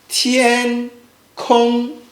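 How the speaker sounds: TIAN KONG, slow flat syllables; first tone. Shown as a demonstration. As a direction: hold tian and kong level, each drawn out.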